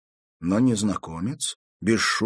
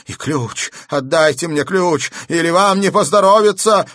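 raised, insistent loud voice; threatening demand; 9 semitones higher, 8 dB louder than usual. A narrator's reading. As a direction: build to a loud, high threat. Madman demands key with threat. (требовательно, переходя к угрозе)

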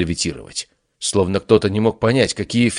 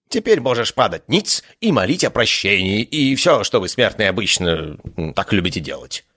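vain god voice; 2 semitones higher than usual. Acted. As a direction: lord it over the mortal. God grants audience.

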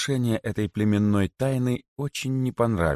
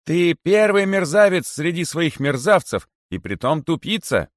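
cheeky insult; 10 semitones higher, 4 dB louder than usual. A narrator's reading. Cheeky rabbit insults the great python, taunting him. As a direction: hurl the insult brazenly in a high voice. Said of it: "воскликнул дерзкий кролик".

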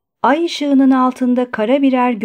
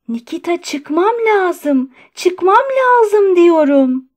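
high, agitated plea building louder; plead, then insist, stretching the last words; trembling insistence; 8 semitones higher, 5 dB louder, slower than usual. A narrator's reading. Patient begging Nikita to let him out, trembling and insistent. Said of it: "Sesi titremeye başlamıştı".

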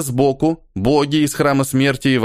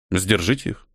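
cold, detached and quieter; indifferent; 5 semitones lower, 4 dB quieter than usual. A narrator's reading. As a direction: toss it off without caring. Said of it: "равнодушно сказал Ниэль".

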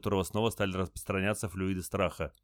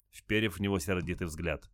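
usual speaking voice

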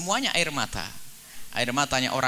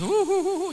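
very high hammed-up boo-hoo; mock a fake crier with silly sobs; hu hu hu in an exaggerated falsetto; fake sobbing. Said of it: "nangisnya dibuat-buat".

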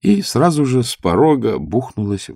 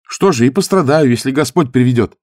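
proud answer, higher and louder; 7 semitones higher, 7 dB louder than usual. Acted. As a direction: declare it proudly. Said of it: "гордо ответил юноша".

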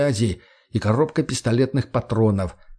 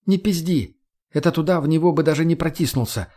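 put-on higher-pitched young woman character, complaining; pitched-up female character voice; feisty Margo, indignant; 6 semitones higher than usual. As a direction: voice her higher, protesting crudely.

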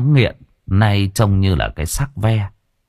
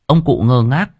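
ordinary delivery, nothing marked

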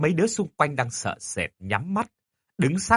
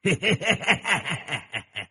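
evil cackle; 5 semitones higher, 4 dB quieter than usual. Acted. Doll laughs.

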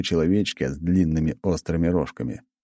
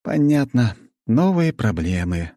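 weary sigh; 6 semitones higher, slower than usual. A narrator's reading. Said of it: "вздохнул я".

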